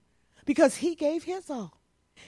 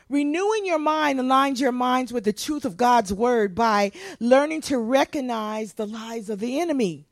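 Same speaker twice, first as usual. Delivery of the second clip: normal talking voice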